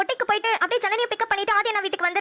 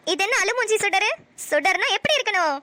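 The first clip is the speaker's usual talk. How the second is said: usual speaking voice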